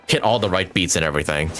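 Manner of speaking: ordinary talk, nothing marked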